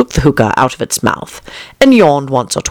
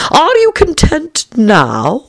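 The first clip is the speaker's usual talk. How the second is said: high, slow caterpillar question; languid caterpillar; 13 semitones higher, much slower than usual. A narrator's reading. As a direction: draw the question out loftily.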